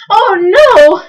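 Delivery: high, loud cry of dismay; gasped horror. A narrator's reading. Bartholomew appalled at the captain's plan, gasped. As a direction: exclaim aghast. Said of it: "gasped Bartholomew".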